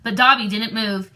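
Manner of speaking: usual speaking voice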